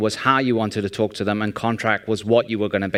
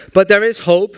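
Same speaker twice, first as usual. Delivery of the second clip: but there is hope, higher and louder; excited; 8 semitones higher, 6 dB louder than usual. Real, not acted. Speaker rallying the audience, rising excitement. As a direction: announce hope to the crowd with a lift.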